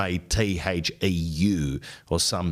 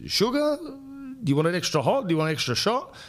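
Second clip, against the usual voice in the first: barista voice. Retold or imitated. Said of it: "You know what the question is?".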